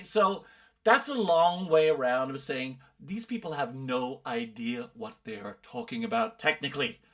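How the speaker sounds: ordinary talk, nothing marked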